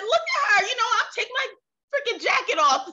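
a squeaky, cheeky inner voice daring herself; high mock self-talk. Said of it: "and I said".

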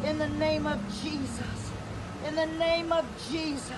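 hushed prayer. Real, not acted. Woman under arrest quietly praying against the abuse, hushed. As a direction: pray under the breath for deliverance.

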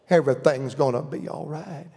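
gentle, hushed reassurance; compassionate. Retold or imitated. Mama reassuring her baby, tender and compassionate. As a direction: soothe like a mama comforting her child. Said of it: "My daddy wouldn't say it quite compassionate".

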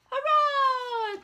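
high, loud cheer. Acted. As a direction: shout hurrah in triumph. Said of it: "cheered Mister Knight".

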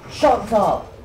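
yelling. Real, not acted. Gamer yelling at the game announcer, loud snap. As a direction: bark it at the screen.

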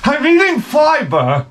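high, slow, prissy mock woman's voice; sing-song it like a smug dieter. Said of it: "what they tell their friends".

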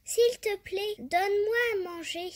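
high, loud, fast pleading; begging cicada. Acted. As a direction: beg the ant for food. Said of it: "supplia la cigale".

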